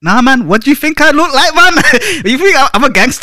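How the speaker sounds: loud, high-pitched protest; mock indignation. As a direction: protest loudly, tipping into laughter. Mock-indignant reply to gangster question.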